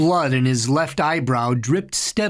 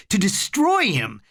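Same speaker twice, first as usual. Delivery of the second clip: ordinary delivery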